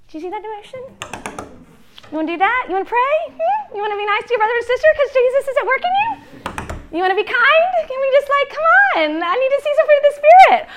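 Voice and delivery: mock nagging mom. Mom pestering her kids for spiritual fruit, mock high nagging. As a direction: plead in a hammed-up high-pitched voice, building in desperation.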